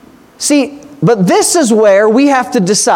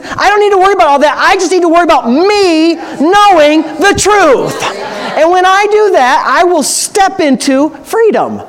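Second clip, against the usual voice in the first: higher-pitched, driving sermon delivery; impassioned preaching; 6 semitones higher than usual. Real, not acted. Preacher urging the congregation toward freedom, impassioned preaching. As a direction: preach it with rising urgency, stretching the key words.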